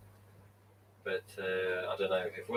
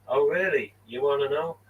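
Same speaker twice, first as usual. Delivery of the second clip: higher, louder coy tease; imagined teasing reply; 5 semitones higher, 4 dB louder than usual. Pretend. Imagining RJ teasing about the mask, coy playful tease. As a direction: play coy, dangling a secret. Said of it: "I can imagine it now".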